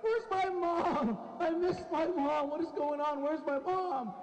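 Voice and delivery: high, drawn-out cries for mom; wailing child. Acted. Smuggled boy in the back seat, wailing for mom. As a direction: play a distressed little boy, mock wailing.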